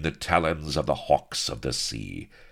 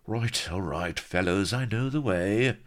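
higher-pitched resigned protest, rising at the end; murmuring old hand; 6 semitones higher than usual. A narrator's reading. Old hand being frog-marched by pirates, murmuring. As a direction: placate them, lifting the pitch as you go. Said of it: "was murmuring".

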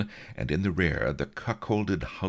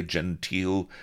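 ordinary delivery, nothing marked

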